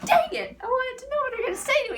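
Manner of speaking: very high and loud, then fast; squealing mock frustration; frustrated fangirl. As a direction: cliffhanger outrage, hammed up. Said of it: "I'm like".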